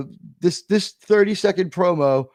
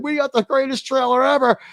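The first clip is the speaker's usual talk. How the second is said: high-pitched mock boast; imitated elation, pitched up; mock self-congratulation; 8 semitones higher than usual. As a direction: crow it high, imitating smug high-fivers. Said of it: "they're already high-fiving".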